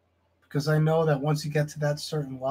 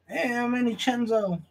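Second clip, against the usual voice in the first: a light high mocking intro; mock rival intro; 6 semitones higher than usual. Mocking a rival's intro, lightly voiced and higher. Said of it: "That's what he does".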